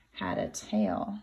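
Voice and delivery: low secret whisper. A narrator's reading. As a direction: let the reveal slip out in a whisper. Narrator reveals the clue.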